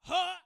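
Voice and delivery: sharp loud grunt at the lash; an overdone cry at the whip. Retold or imitated. Playing the soldier striking, loud grunt. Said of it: "I went".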